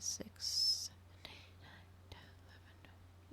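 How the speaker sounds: whispered counting under the breath. Real, not acted. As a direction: count the days, fading to a whisper. Counting days, trailing off.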